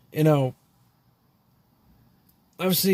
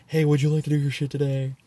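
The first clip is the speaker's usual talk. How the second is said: lightly voiced telemarketer pitch; mock sales-call voice. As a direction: put on a hint of a phone salesman. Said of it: "telemarketer calling".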